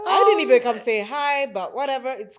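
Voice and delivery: high, loud, laughing through the words; loud laughing disbelief; laughing protest. Retold or imitated. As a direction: protest while cracking up. Retold confrontation, laughing.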